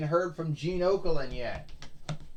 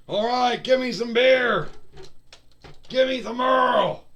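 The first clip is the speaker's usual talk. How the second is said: higher, louder gruff wrestler yell; Stone Cold voice; 8 semitones higher, 7 dB louder than usual. Acted. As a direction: demand beer like a cocky brawler. Stone Cold calls for beer.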